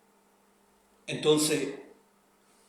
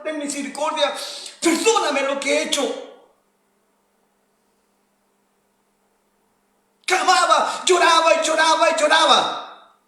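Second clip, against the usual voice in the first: loud, high impassioned preaching; preaching at full force; 8 semitones higher, 6 dB louder than usual. Acted. Preacher rousing the congregation with full force. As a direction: preach it out with rising fervour.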